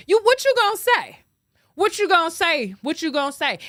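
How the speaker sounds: high, fast taunt that winds down; taunting challenge. Real, not acted. Taunting critics who can't argue back, loud and high. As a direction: dare them to answer.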